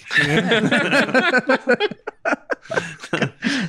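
long high burst of laughter. Real, not acted. Big laugh.

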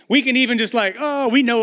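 hammed-up church fervor; fake worship. Shown as a demonstration. Pastor showing the congregation how to fake worship, hammed-up. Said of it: "watch this, we can even fake worship".

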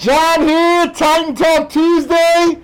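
talking through a face mask; muffled through mask. Real, not acted. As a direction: voice muffled behind cloth. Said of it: "Sorry about being muffled".

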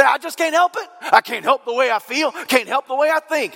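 high, whiny Southern hillbilly voice. Retold or imitated. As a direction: squeak out a hillbilly's excuse. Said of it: "all my impersonations sound like a, you know, a redneck hillbilly".

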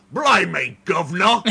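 loud mock cockney exclamation; cockney accent. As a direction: shout it like a stage Cockney. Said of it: "she wasn't gonna talk like".

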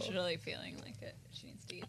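hushed playful whisper. Pretend. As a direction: whisper it like a secret aside. Whispered aside about bacon craving.

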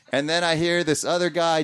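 ordinary delivery

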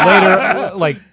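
bursting into laughter mid-word; laughing. Real, not acted.

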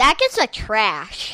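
loud. Real, not acted. Loud slow verdict on cupcake.

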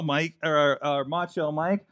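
ordinary delivery

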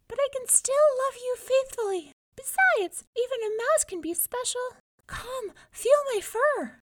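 very high, squeaky little mouse; tiny voice. A narrator's reading. Mouse persuades the young man she is special, tiny voice. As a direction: plead sweetly in a tiny mouse voice. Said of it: "came a tiny voice".

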